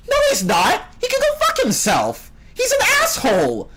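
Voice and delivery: heated high-pitched shouting; angry rant. Real, not acted. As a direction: yell it, fed up with the fans. Ranting against the fans.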